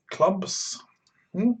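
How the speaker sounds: normal talking voice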